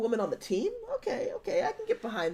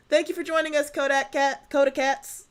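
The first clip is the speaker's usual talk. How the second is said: announcer voice; 6 semitones higher, 7 dB louder than usual. Pretend. Loud high announcer aside.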